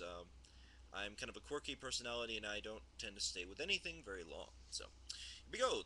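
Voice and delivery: usual speaking voice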